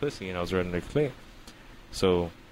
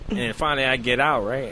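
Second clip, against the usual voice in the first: ordinary delivery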